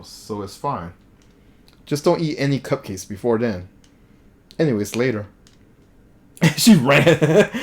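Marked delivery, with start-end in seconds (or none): 6.4-7.6 louder, higher laughing line. Real, not acted. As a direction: laugh it out, amused. Laughs at fleeing character.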